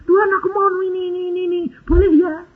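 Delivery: high, rattled-off imitation of praying; mock demanding prayer. Preacher mimicking believers giving God orders, mock pleading. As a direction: reel off the wishes in a high pleading voice. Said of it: "kalau doa bilang".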